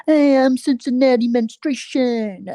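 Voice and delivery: lightly voiced showy drag queen, slow and drawn out; a hint of a camp queen introducing herself, slowly; mock drag intro. Vlogger imitating a queen's intro, mock showy.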